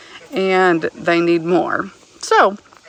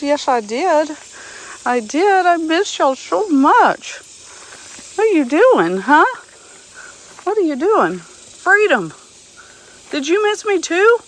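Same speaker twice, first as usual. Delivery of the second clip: much higher, cooing animal talk; pet talk; 8 semitones higher than usual. Real, not acted. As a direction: gush over the donkeys, sing-song. Greeting her donkeys fondly.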